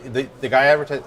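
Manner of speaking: normal talking voice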